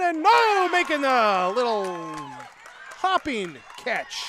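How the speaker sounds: rising excitement, loud and high; excited play-by-play. Real, not acted. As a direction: call the big catch with a burst. Exciting catch called live.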